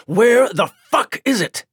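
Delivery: high, loud furious vampire demand; a snarling boss shaking her down; angry demand. Acted. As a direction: bark it at her, enraged. Villain demands the hidden item.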